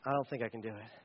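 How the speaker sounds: low, quiet, hesitant admission; hushed doubt. Acted. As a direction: murmur it low, as if backing out. Acted fearful reluctance to obey God.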